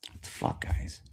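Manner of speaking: hushed, voiceless mock dismay; imagined disapproval. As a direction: mutter it softly as an appalled critic. Quoting disapproving critics of his fans.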